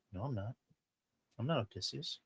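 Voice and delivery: very soft retold denial; hushed denial. Disguised Odysseus denying who he is, hushed. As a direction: drop to a near murmur.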